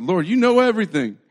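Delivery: loud, high pleading outburst; wounded, emphatic cry to the Lord; hurt protest. Acted. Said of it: "Peter was hurt that Jesus asked".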